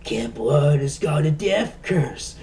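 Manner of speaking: slow doom-prophet voice; slow ominous raspy drawl. Retold or imitated. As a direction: warn slowly like a crazy old man. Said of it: "has to be Crazy Ralph".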